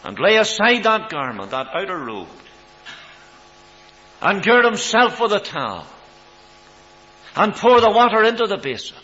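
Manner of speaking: loud, high preacher's cry; preaching at full force. Acted. Preacher to the congregation, loud and ringing. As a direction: rise to a ringing pulpit climax.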